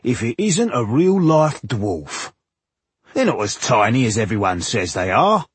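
lightly voiced gawping sentry; a touch of a sentry's put-on voice, marvelling; muttering sentry. A narrator's reading. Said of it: "one of them muttered".

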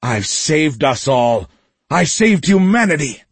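impassioned shout climbing high. A narrator's reading. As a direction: build to a high, loud boast. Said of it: "Jordan shouted at them".

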